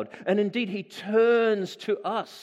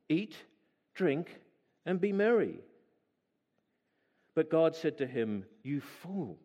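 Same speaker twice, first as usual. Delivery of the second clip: measured, steady Bible reading to the congregation; scripture reading; 4 semitones lower than usual. Acted. Preacher reading Luke to the congregation, measured and even. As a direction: read the passage aloud evenly for the church.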